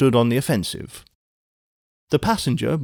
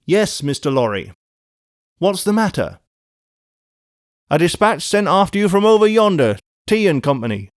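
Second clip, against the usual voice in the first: high, raised-voice exchange; calling out; 7 semitones higher, 4 dB louder than usual. A narrator's reading. Messenger and passenger through the mist, calling out. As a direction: call it across a distance.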